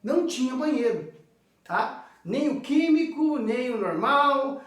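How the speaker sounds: ordinary delivery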